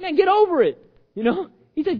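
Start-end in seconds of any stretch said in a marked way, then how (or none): 0.0-0.8 loud, high, impatient order; exasperated rebuke. Acted. God jokingly skipping the comfort, exasperated. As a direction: scold with mock impatience.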